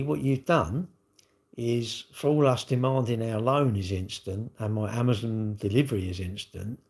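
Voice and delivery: normal talking voice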